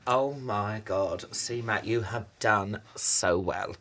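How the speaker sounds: slow, low and impressed; mock awe. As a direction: draw it out in hushed self-congratulation. Awed at a luxury hotel.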